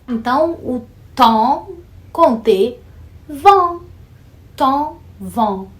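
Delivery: match the vowel in tom and vin; tom vs vin contrast. Shown as a demonstration. Teacher contrasting tom and vin for students. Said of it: "a mesma vogal nasal".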